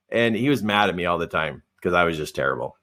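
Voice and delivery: ordinary talk, nothing marked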